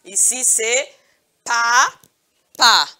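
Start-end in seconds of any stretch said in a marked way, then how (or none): 1.4-2.9 "papa" as two separated beats, pa, pa; loud, rising first pa, pause, falling second pa; syllable by syllable. Shown as a demonstration. Teacher showing two syllables, "papa" split in two. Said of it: "Le mot papa a deux syllabes".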